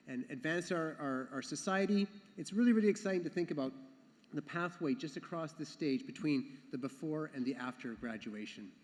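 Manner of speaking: ordinary talk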